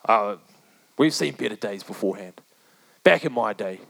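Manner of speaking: imitating a grumpy old churchgoer; old grumbler. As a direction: mope like a crusty old man recalling better days. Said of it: "just a room full of grumblers".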